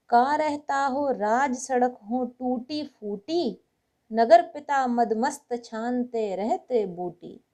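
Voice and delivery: loud, rhythmic declaiming of satirical verse; projected Hindi verse reading with drawn-out line endings; comic poem recitation. Acted. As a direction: recite it with a lilt, building to the punchline. Recites second short satirical poem.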